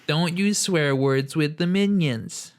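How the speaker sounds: put-on high, slow, scolding old lady voice; indignant old woman. Imitating a mad middle-aged woman defending the minions, high and slow. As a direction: lecture like a prim offended granny. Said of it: "this old woman who was just like".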